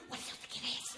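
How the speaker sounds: unvoiced, hushed question; Lauren whispers to her husband in the dark; whispered. A narrator's reading. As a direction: ask it in a breathless whisper. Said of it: "Lauren sat bolt upright and whispered".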